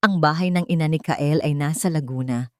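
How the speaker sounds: ordinary delivery, nothing marked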